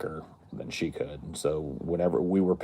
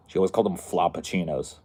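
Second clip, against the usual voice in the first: ordinary delivery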